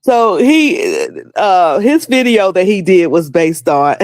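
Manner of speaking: usual speaking voice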